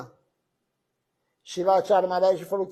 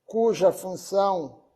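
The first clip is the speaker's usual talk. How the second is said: slow, deliberate reading with long pauses between phrases; formal devotional tribute reading; solemn reading aloud; 4 dB louder, slower than usual. Acted. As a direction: read it out measured, phrase by phrase. Reads a tribute text aloud.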